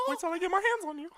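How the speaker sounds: very high, loud cartoon squeak; piercing falsetto mock threat; squeaky mouse threat.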